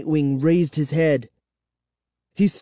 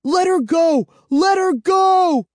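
high, loud scream of alarm; desperate scream; 4 dB louder than usual. A narrator's reading. Child screaming at a vampire to release Kara, desperate scream. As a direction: scream it high, drawing out the last word. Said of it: "I screamed".

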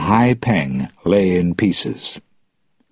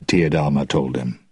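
ordinary delivery, nothing marked

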